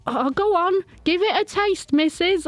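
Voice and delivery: high, coaxing lure; coaxing old woman. Acted. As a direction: sweet-talk her in, high-pitched. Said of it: "So I'm like".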